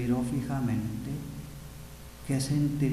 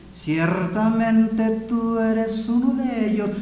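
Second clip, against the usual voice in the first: slow, drawn-out, raised accusing voice; louder, lingering, certain accusation; insistent accusation; 7 semitones higher, 6 dB louder, slower than usual. Acted. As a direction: stretch the words as you point him out. Third accuser insists, acted out.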